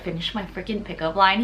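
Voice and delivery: ordinary talk, nothing marked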